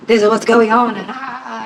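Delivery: high and tearful, fading to a choked near-whisper; crying to dad. Retold or imitated. Teenage girl crying to her dad about forgotten words, tearful. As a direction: voice cracking as the words run out. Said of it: "I turned to my dad in tears".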